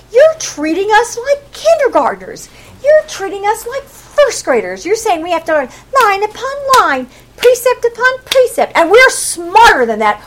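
indignant mockery. Tribes answering Isaiah, indignant and mocking. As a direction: protest at being talked down to. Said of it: "those sinful people making fun of Isaiah".

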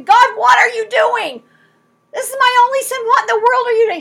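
high, loud, near-tearful cry of protest; pleading protest. Pretend. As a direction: cry out to God in anguish. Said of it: "do you notice he's not arguing".

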